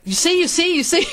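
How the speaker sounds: high, insistent retold exclamation; excited brother. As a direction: crow it excitedly, pitched well up. Excited brother voiced in retelling.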